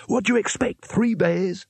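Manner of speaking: mock the young one; wizard leader. A narrator's reading. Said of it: "snarled the leader".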